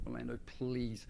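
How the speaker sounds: hushed retold begging; quiet, understated desperation; desperate plea. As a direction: plead softly with a close friend. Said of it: "I was desperate, honestly".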